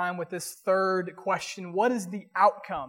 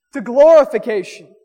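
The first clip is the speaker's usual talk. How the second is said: preaching swell; 12 dB louder than usual. Real, not acted. Pastor to the church, loud triumphant preaching. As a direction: ring out the last word loud.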